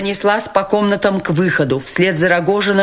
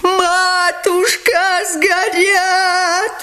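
much higher, slow, drawn-out wail; 14 semitones higher, much slower than usual. A narrator's reading. Lebedev wailing over the burning money. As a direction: lament the burning money in a high sing-song. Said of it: "вопил Лебедев".

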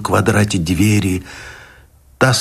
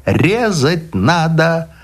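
медленно, выше обычного, зловеще; приговор демона, протяжно; демонский голос; 6 semitones higher, slower than usual. A narrator's reading. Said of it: "демонским голосом пел страх". (демонский приговор)

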